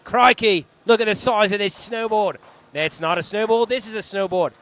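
mock Aussie accent, loud on Crikey; playful Crocodile Dundee-style Aussie accent. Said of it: "Doing Aussie accent".

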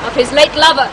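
usual speaking voice

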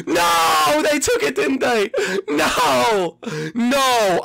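very high, loud cries of no; despairing shout. Real, not acted. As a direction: wail at losing it. Rival took the prize.

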